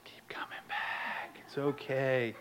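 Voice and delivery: slow, hushed, patient reassurance; soothing sponsor. Retold or imitated. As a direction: soothe softly, almost whispering. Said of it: "And he'd be like".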